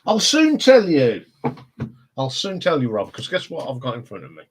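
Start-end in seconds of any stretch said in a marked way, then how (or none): 0.1-1.2 drawn out, higher and louder, a sing-song tease; teasing. Acted. Holding back news from Rob, playful teasing drawl. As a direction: dangle the secret in front of Rob.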